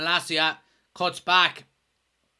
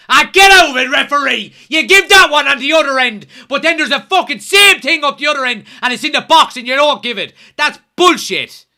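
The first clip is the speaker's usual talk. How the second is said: ranting at the referee, much higher and louder; carried away, angry; 9 semitones higher, 12 dB louder than usual. Real, not acted. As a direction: lose your temper at the ref, slowing to a crawl on the last words. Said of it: "I'm getting a bit carried away here".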